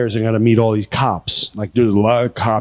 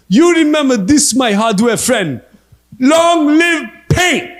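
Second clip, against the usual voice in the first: high, loud put-on Libyan accent; mock Gaddafi accent; 11 semitones higher, 4 dB louder than usual. Prank call to the clerk, mock Gaddafi accent. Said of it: "This is Muammar Gaddafi".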